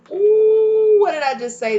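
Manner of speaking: sudden high, loud ooh; surprised squeal. Real, not acted. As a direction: caught off guard by the card, squeal it out. Surprised by card just drawn.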